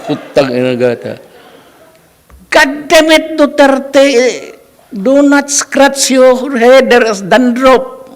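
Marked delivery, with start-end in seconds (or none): 2.5-8.0 much higher, louder, slow put-on voice of a strict professor; mock professor, scolding. Professor mocking the student's dandruff, high hammed-up scolding. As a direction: ham up the professor's insult, ending in laughter. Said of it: "professor mocks his dandruff".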